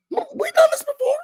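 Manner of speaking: high, cracking laugh through the words; words broken up by laughter. Real, not acted. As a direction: laugh it out in disbelief. Laughing at the mama's reply.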